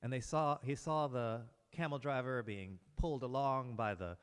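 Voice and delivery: usual speaking voice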